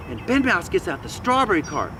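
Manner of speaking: ordinary delivery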